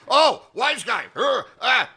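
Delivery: high, swooping comic outburst; mock wise-guy taunt. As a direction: put on a high-pitched cartoon tough guy. Retold tough guy confronting him.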